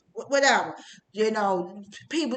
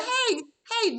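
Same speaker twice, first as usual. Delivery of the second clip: high, sugary mock greeting, much higher; phony sweetness; 9 semitones higher than usual. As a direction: imitate a fake-friendly church lady cooing. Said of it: "This is so phony".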